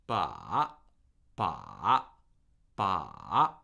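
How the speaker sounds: "Ba." dipping low and rising, shorter takes; third-tone demo. Shown as a demonstration. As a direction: compact falling-rising "Ba.". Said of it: "third tone has this characteristic trough".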